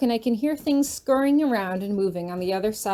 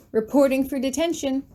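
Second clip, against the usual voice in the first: resolute; 5 semitones higher than usual. Acted. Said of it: "he says resolutely".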